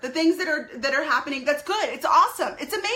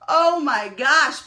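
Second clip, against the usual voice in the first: usual speaking voice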